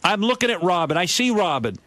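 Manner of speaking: ordinary talk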